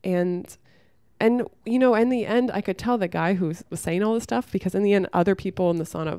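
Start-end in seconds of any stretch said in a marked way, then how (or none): none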